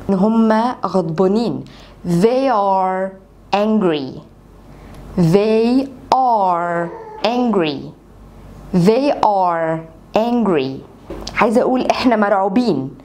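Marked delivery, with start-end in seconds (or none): 2.0-10.8 very slow, each word drawn out; slow drill. Shown as a demonstration. Teacher repeating the sentence for learners, very slow. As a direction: drill it slowly for students. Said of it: "احفظ الجملة زي ما أنا بقولها لك كده".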